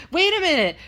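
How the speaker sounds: put-on high, loud child voice; puzzled little kid. Kid challenging Spider-Man, imitated puzzled child. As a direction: blurt it like a kid catching someone out. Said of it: "the third kid to my left was like".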